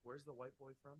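muttered far from mic; quiet aside. Real, not acted. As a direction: mumble to yourself off-mic. Muttered aside while searching phone.

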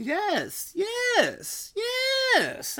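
escalating cry of success; triumphant yeses. Real, not acted. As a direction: build to a high, loud "yes". Remembering what he was doing.

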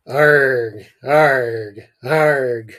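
loud barked animal noise; barking arg. Acted. The boy with no words barking at his brother, loud. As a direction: bark it out like a dog. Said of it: "barked Richard".

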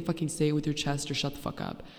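ordinary delivery

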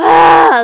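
sudden full-throated scream of pain; anguished scream. Retold or imitated. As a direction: let out a raw yell of pain. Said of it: "estaba como".

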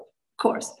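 breathy sarcastic whisper; mocking herself under her breath; whispered sarcasm. Real, not acted. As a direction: whisper it like a guilty confession. Sarcastic aside about herself.

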